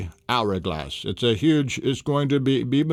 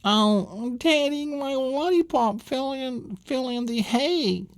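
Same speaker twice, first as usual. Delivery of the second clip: put-on high, slow little girl whine; a child calling to her daddy; 14 semitones higher, slower than usual. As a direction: play the small girl who dropped her candy. Little girl voiced in story.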